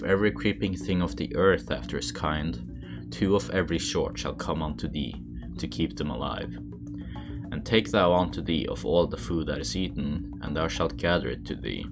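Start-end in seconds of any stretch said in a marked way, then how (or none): none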